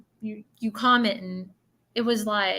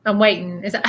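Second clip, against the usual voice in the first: ordinary talk, nothing marked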